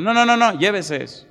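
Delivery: high, loud no-no-no pushing back; an older woman insisting he take the card; insistent refusal. Retold or imitated. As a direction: brush off his polite refusal firmly.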